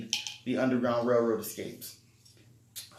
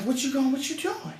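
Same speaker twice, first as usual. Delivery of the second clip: imitating mom; 13 semitones higher than usual. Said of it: "So she's like".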